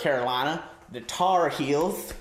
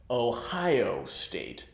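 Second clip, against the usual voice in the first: drawn-out Ohio State; 3 semitones lower, 4 dB quieter, much slower than usual. Shown as a demonstration. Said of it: "So shouldn't they be T O S U?".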